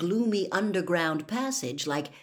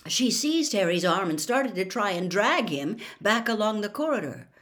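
ordinary delivery, nothing marked

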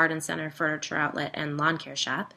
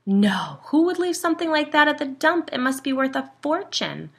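loud, high-pitched incredulity; shocked, pitched-up outburst; astonished disbelief; 10 semitones higher, 8 dB louder than usual. A narrator's reading. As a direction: exclaim in amazement at the find. Disbelief at treasure left at dump.

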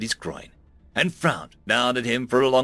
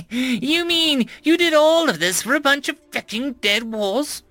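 high, louder screech of disbelief; 13 semitones higher, 4 dB louder than usual. A narrator's reading. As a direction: shriek it at him. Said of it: "she screeched at Seamus".

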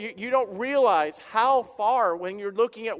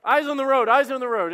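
higher, louder mock warning to the driver; imitating wife's warning; 6 semitones higher, 7 dB louder than usual. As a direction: play the wife snapping at the distracted driver twice. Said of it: "and Jen's like".

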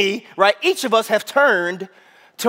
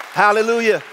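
usual speaking voice

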